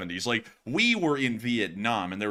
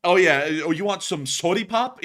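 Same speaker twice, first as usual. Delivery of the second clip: high, loud mock Midwestern accent; hammed-up folksy Midwesterner accent; 8 semitones higher, 6 dB louder than usual. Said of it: "that Midwestern accent thing".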